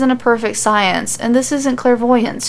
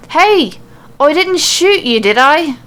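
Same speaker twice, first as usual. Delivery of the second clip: high, louder shout across the road; calling yell; 6 semitones higher, 5 dB louder, slower than usual. A narrator's reading. Becky shouting across the road to whoever she hit, loud yell. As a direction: call out loud toward the bushes. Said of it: "and then a yell".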